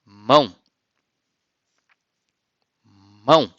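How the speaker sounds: Mão with a nasal ow sound; Portuguese mão. Shown as a demonstration. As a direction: model word Mão, clear. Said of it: "Como você diz hand".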